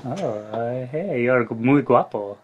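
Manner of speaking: a hint of a seductive Spanish compliment; flirty Spanish accent. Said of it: "I know you're lying, but it's great".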